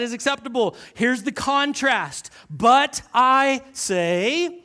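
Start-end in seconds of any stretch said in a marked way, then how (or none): 2.5-4.6 very slow, loud and drawn out; stretched, weighty scripture reading; read really slow. Shown as a demonstration. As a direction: let every word land for slow absorbers. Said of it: "I'm gonna read this really slow".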